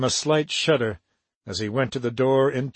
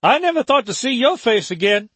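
high, loud call across a courtyard; 9 semitones higher, 4 dB louder than usual. Acted. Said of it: "she called to him".

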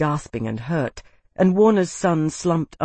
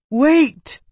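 high, loud cry; 11 semitones higher, 6 dB louder than usual. A narrator's reading. Isana crying out to halt the fight, loud. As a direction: cry out to stop them. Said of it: "Isana cried".

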